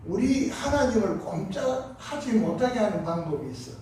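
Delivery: ordinary delivery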